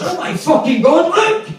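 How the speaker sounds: shocked shriek. Retold or imitated. Date shrieking at the mauled rabbit in shock. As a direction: screech it out in horror.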